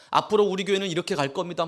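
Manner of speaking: fast, stress on 앞으로; rushed vision speech. Shown as a demonstration. Preacher mimicking the senior pastor pushing his vision, fast with 앞으로 stressed. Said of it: "막 하는데".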